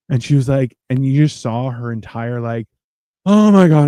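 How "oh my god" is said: high, loud gush of thanks; gushing relief. Retold or imitated. Cashier thanking an easygoing customer, gushing. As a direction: burst out with exaggerated gratitude.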